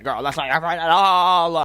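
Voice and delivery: loud, rising wail on the last words; emphatic outburst. Real, not acted. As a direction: belt out the warning, stretching ALL. Warning repeated with drama.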